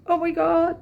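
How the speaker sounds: high, loud, squeaky panicking dog. Retold or imitated. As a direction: voice the dogs as if they're shrieking in alarm.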